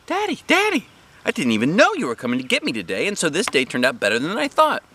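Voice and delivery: put-on thrilled kid running to her dad; excited little girl. A narrator's reading. Junie B surprised to see Daddy.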